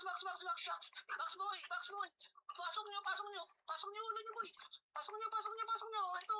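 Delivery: high-pitched urgent game call; rapid-fire repeated command, pitched high. Real, not acted. Urgent shot-calling during game push.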